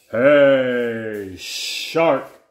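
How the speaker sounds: loud, drawn-out call; big storybook giant's hail; booming whale. Acted. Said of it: "boomed the whale".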